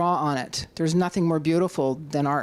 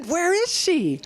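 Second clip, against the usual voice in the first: high, craning, curious; mock curious lawyers; 11 semitones higher, slower than usual. Speaker imitating judge and lawyers, curious and high. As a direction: act out people dying to know. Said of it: "went like this".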